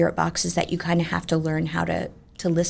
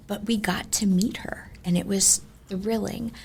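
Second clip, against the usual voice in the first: breathless whisper; spooky ghost tour hush; 5 dB quieter than usual. Acted. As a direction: whisper the thrill, hushed. Building suspense in ghost story.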